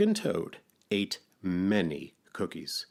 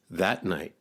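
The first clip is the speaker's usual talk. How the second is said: plain reading voice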